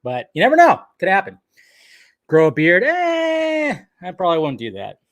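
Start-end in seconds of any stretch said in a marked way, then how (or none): 2.8-3.8 long, high-pitched noise of reluctance; doubtful "eh". Real, not acted. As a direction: stretch out a high, noncommittal eh. Hesitant reply to growing a beard.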